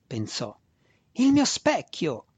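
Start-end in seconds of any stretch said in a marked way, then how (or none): 1.1-2.3 high, drawn-out wail of dismay; lamenting. A narrator's reading. Faceless master over his broken mirror, lamenting. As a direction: lament the loss, slowly and high. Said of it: "senza volto che si lamentava".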